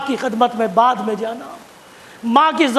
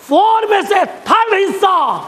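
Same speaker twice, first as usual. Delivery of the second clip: raised, slow, emphatic proclamation; emphatic call; 6 semitones higher, slower than usual. Real, not acted. Preacher to congregation, stressing the mother's share, raised and slow. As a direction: drive the fraction home to the crowd.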